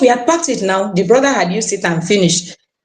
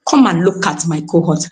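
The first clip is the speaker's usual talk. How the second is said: usual speaking voice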